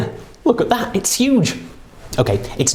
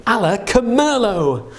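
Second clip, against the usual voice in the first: higher, slow showman spell; kids party magician; 5 semitones higher than usual. Pretend. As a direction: announce it like a stage magician. Casting a spell for wine.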